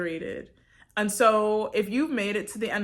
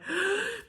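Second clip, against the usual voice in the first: sharp gasp; 11 semitones higher, 4 dB quieter than usual. Retold or imitated. Struggling hiker asked if okay.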